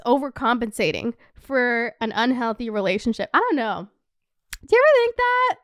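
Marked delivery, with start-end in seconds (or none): 4.7-5.6 loud question. Real, not acted. Asks viewers, sudden loud.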